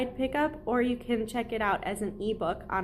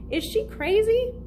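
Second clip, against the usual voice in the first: much higher and louder, incredulous; shocked shout; 12 semitones higher, 6 dB louder than usual. A narrator's reading. Relative yelling at the room in outrage: shocked shout. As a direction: fling the question out in disbelief.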